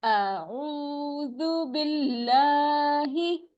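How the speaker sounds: slow, stretched recitation; measured tajweed chant; Quranic recitation. Shown as a demonstration. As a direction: recite slowly with long vowels. Recites the phrase.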